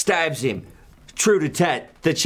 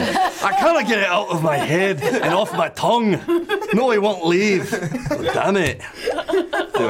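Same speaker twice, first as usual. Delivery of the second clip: laughing, higher and louder; laughing at himself; 12 semitones higher, 4 dB louder than usual. Real, not acted. Game master laughing while trying to find an accent. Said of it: "stuck on Sam now".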